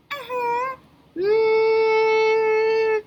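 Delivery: high, slow, squeaky puppet greeting; a cheerful little puppet says hello; high cartoon puppet voice calling out; puppet Leo voice.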